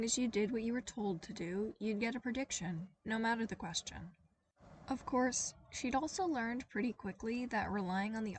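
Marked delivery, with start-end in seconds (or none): none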